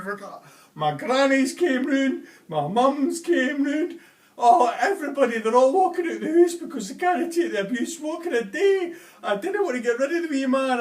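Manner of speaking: high, loud, frantic cry for help; panicked plea. Acted. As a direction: plead in a high voice, near hysterics. The panicked customer pours out his troubles.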